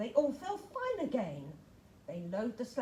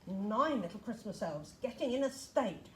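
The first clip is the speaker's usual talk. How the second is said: usual speaking voice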